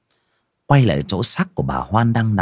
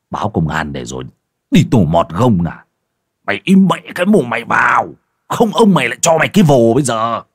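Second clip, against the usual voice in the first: angry threat; 4 dB louder than usual. A narrator's reading. Husband warning wife off police, angry building threat. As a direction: threaten her into silence, getting louder.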